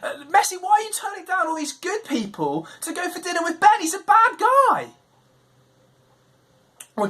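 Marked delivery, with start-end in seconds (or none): 0.0-4.9 outraged "what?!" at Messi's choice; shocked disbelief. Retold or imitated. Kid at school protesting Messi's dinner pick, high incredulous outburst. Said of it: "You'd be like".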